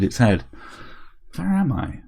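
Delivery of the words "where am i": soft, high, feminine vampire-bot voice; accented feminine robot. A narrator's reading. Rebooted bot asks Harry where it is, feminine. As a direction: wake up confused, softly, in a high accented voice. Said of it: "more feminine intonation".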